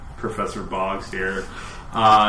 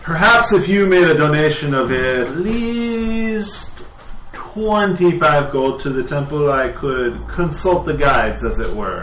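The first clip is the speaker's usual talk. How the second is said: measured priest, pitched up then settling; suggestive temple priest; 5 semitones higher, 4 dB louder than usual. Acted. Priest asks visitors for a temple donation, tactful and suggestive. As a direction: float the request delicately, stretching the start.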